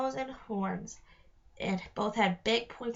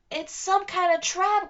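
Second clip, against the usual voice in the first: alarmed; 8 semitones higher, 6 dB louder than usual. Acted. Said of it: "Connor said".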